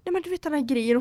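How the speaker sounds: normal talking voice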